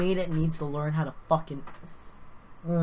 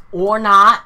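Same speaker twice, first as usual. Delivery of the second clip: loud, exasperated shout, pitched up; mad; 4 semitones higher, 15 dB louder than usual. Real, not acted. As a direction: burst out loudly, fed up with the software. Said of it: "I'm fucking getting mad".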